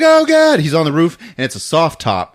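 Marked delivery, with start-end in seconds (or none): 0.0-0.6 high, loud panicked yelp; hammed-up alarm; mock panic. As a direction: cry out as terrified passengers. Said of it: "And they're like".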